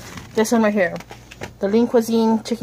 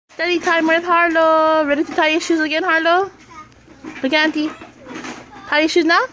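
encouraging auntie voice; 6 semitones higher than usual. Real, not acted. Aunt coaching little niece.

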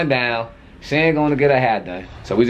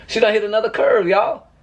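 said through a laugh, higher; laughing in disbelief as the news sinks in; 7 semitones higher than usual. Real, not acted. Repeating the curve news to viewers, laughing in disbelief.

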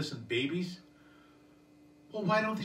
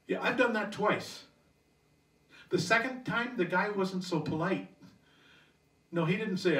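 usual speaking voice

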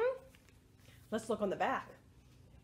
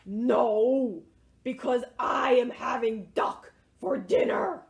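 loud, lowered villain-like announcement; menacing dog; 3 semitones lower, 5 dB louder than usual. Acted. Dog answering mouse from the doghouse, loud menace. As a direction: boom it out with a long "no".

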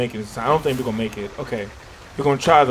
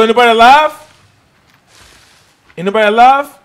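louder, raised voice searching for someone; calling out; 5 semitones higher, 9 dB louder than usual. Real, not acted. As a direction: call out into the dark for survivors. Calling out at crash site.

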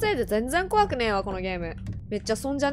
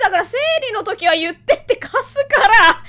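shouting; 8 semitones higher, 9 dB louder than usual. Pretend. Shouting at ghost.

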